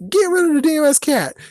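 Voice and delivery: high, loud mocking shriek; mocking the neighbors. Mocking the angry neighbors in a high shriek. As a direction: squeal the demand like an angry mob. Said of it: "mocking the neighborhood's demand".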